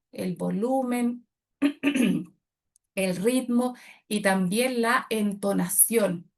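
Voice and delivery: very slow, each term set apart; slow, deliberate listing. Shown as a demonstration. Coach spelling out elements, slow and deliberate. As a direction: lay out each word slowly. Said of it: "coach listing voice elements".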